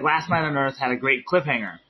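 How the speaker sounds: usual speaking voice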